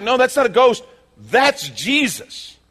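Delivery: loud, high cry of alarm; freaked-out disciples. Acted. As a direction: call it out high and loud, stretching the end. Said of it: "and they're freaking out".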